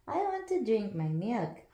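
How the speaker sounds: usual speaking voice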